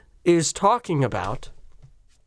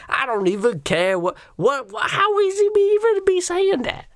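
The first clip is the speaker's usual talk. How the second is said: Whoopi Goldberg; 14 semitones higher than usual. Acted.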